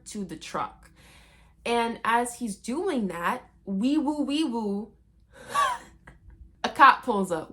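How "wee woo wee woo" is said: siren imitation.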